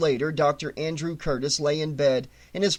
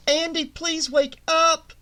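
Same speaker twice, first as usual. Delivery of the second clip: higher, slowed faraway plea; distant woman calling; 9 semitones higher, slower than usual. A narrator's reading. Gene calls Andy from outside the dream, distant and high. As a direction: call him back from far away.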